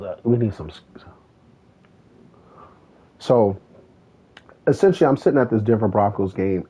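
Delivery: ordinary talk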